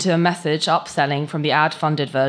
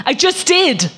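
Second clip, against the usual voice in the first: much higher, louder snap; exasperated shout; 13 semitones higher, 7 dB louder, slower than usual. Acted. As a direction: bark back in exasperation. Cam exasperated at bot.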